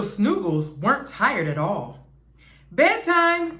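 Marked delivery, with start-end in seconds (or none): 2.7-3.6 high called-out bedtime call; dad calling the kids to bed. A narrator's reading. Dad calls kids to bed, raised call. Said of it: "cried Dad".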